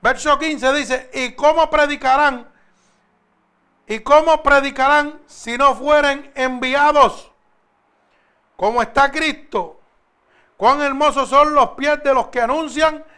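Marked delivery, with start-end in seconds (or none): 1.1-13.0 emphatic scripture reading. Acted. Preacher reads verse fifteen on those sent to preach, slow and forceful. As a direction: declaim it slowly at full voice.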